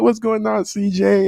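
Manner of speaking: playing the cool kid saying hi; imitating a kid friend. Voicing Giovanni's greeting.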